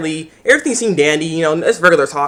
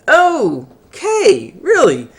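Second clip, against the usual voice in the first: incredulous okay really; 8 semitones higher, much slower than usual. Retold or imitated. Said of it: "and I'm like".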